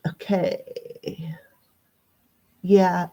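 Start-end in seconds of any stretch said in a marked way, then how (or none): 0.1-1.4 soft, breathy whispered okay; drawn-out hushed aside; under the breath. Real, not acted. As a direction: say it quietly to yourself. Murmuring to herself while reading cards.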